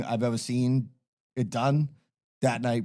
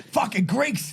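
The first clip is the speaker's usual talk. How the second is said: disgusted scoff; 10 semitones higher, slower than usual. Retold or imitated. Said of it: "And Danny goes".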